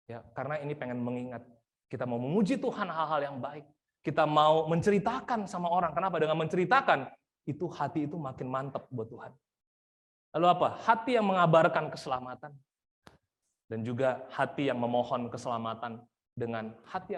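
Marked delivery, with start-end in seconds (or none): 4.0-12.5 impassioned preaching. Acted. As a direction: hammer the point to the congregation. Preaching at full force.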